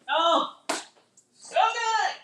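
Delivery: high-pitched squeal of delight; delighted exclamation. Real, not acted. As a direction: savour it, voice leaping high. Delighted by the food.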